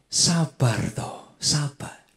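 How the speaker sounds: slow, soft, calming "sabar toh"; a preacher gently soothing an anxious spouse; gentle reminder. Shown as a demonstration. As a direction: coax patience out of them, very slowly. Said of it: "Kadang kita perlu saling mengingatkan".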